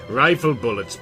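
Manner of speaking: ordinary delivery, nothing marked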